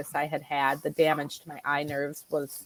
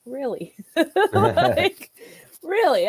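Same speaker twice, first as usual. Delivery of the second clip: high, laughing mock disbelief; 10 semitones higher, 4 dB louder, slower than usual. Patient mocking the iridologist's promise, laughing disbelief. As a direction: scoff at the claim through laughter. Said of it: "And I was like".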